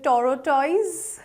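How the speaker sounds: "tortoise" as toro-TOYS; slow, questioning "tortoise" in three syllables; mispronounced tortoise. Shown as a demonstration. Teacher testing learners with wrong tortoise, slowly. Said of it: "Not really".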